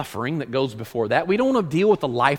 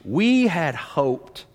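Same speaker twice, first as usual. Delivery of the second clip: "We had hoped" stretched very slowly, word by word; slow, weighted "We had hoped"; drawn out to sink in; much slower than usual. Acted. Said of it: "Let that sink in for just a moment".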